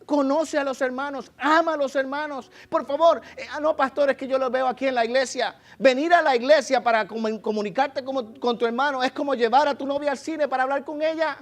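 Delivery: loud, high sermon push; rally the congregation from the pulpit; full-force preacher; fervent preaching. Acted.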